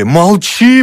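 high, loud urgent hushing order; alarmed cry. A narrator's reading. Mother rushing at her daughter to silence her, alarmed.

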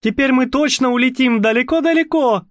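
put-on high, gleeful girl; childlike joy. A narrator's reading. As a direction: delight like a child. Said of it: "Как ребёнок радовалась".

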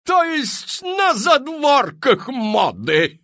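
shocked lady from the crowd; horrified question. Acted. Испуганный вопрос из толпы. (испуганный высокий вопрос дамы)

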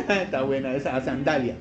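soft low chuckle through the words; real amused laugh over the words. Real, not acted. Preacher laughs at a congregant's quip, softly chuckling.

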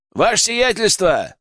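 high, urgent call from afar. A narrator's reading. Guard cries to his lord from behind the gate, calling out. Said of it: "раздался крик из-за ворот".

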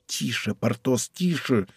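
very soft, urgent shushing; hushing. A narrator's reading. Aramis to noisy Porthos amid falling coins, hushing. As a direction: keep it down, barely voiced.

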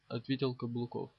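ordinary delivery, nothing marked

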